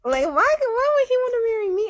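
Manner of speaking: squeaky incredulous rush. Real, not acted. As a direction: blurt it in giddy disbelief. Incredulous outburst.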